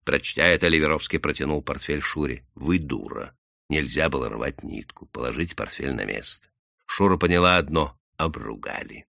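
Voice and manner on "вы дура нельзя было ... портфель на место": low, soft reprimand; тихий выговор. A narrator's reading. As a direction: scold her under your voice. Man scolds Shura in low voice. (строго и тихо)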